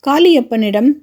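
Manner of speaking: ordinary delivery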